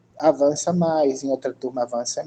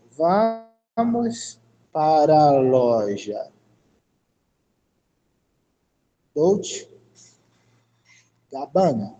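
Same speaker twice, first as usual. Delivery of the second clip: very slow, word by word with long pauses; slow dictation; much slower than usual. Shown as a demonstration. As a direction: stretch out each word for the class. Teacher dictates phrase to translate. (ditado bem devagar, palavra por palavra)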